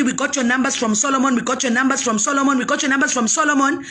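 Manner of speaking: fast, rattled-off repetition of the callers' line; mimicking callers. Mocking callers who got her number from Solomon, rattled off fast. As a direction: rattle it off like a stuck record. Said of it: "call me and say".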